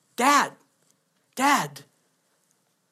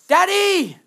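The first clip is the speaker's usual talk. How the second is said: put-on much higher, louder child's call; little girl calling; 9 semitones higher, 5 dB louder than usual. Imitating his daughter calling for daddy again, much higher and louder.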